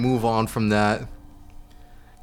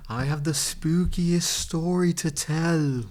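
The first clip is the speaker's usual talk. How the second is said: slow, raised, drawn-out spooky intro; spooky storyteller; 5 semitones higher, slower than usual. Pretend. Storyteller opens the spookiest story, slow and eerie. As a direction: draw it out like a mock ghost tale.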